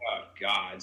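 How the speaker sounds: low, soft, weary aside; understated low groan of exasperation; retold dismay. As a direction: sigh it to yourself in resignation. Retells dismay at the fight.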